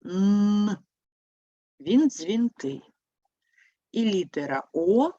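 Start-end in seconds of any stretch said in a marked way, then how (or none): 0.0-0.8 "Н" held as a long hummed nnn; one long, sustained "Н" sound; lengthened consonant. Shown as a demonstration. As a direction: draw out the sound "Н" without a vowel. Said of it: "подовжений звук".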